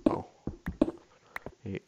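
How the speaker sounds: normal talking voice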